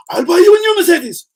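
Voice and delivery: very high, loud falsetto; high-pitched mock excitement; squeaky put-on voice. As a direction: promise it in a loud comic squeak. Comic put-on promise, falsetto.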